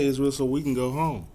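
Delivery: usual speaking voice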